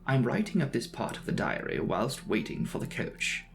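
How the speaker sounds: plain reading voice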